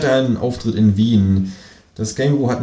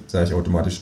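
usual speaking voice

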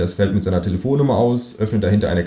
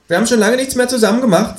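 fast, high complaining kangaroo voice; high-pitched, hurried reproach; reproachful; 4 dB louder, faster than usual. A narrator's reading. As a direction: complain accusingly in a high creature voice. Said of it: "in vorwurfsvollem Ton".

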